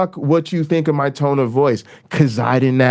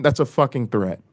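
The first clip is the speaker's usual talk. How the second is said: usual speaking voice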